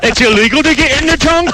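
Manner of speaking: mock surprise. Mock surprise about the law.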